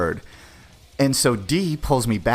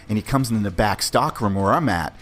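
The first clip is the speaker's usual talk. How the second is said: normal talking voice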